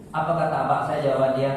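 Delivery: usual speaking voice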